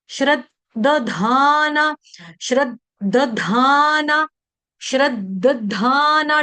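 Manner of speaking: श्रद्धाना spelled out slowly as shra-dda-dhaa-naa with the double द; slow syllable by syllable. Shown as a demonstration. Teacher showing devotees how to say श्रद्धाना, slow and syllable by syllable. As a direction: say श्रद्धाना slowly, sounding both द. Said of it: "यहाँ पर double है".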